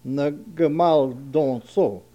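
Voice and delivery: slow ngemal donso with "on" in don; ngemal donso pronounced. Shown as a demonstration. Teacher showing how we pronounce ngemal donso, slowly sounded out. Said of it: "we would pronounce this word".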